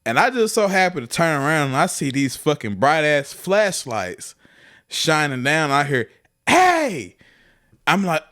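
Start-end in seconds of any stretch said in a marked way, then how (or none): 6.5-7.2 sharp, high-pitched hail; mock warden's high "hey" shout; calling out. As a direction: call out to catch someone. Game warden calls out in story.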